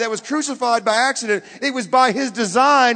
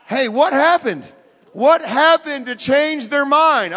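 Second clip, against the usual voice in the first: loud, slow, raised questioning; forceful preaching; 5 semitones higher, 7 dB louder, slower than usual. Real, not acted. Preacher challenging the congregation, forceful and loud. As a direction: hammer the question out slowly.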